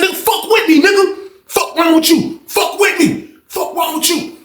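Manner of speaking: high-pitched mock cussing out; retold cursing match. Back-and-forth cursing, retold.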